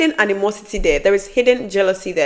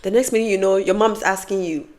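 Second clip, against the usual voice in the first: usual speaking voice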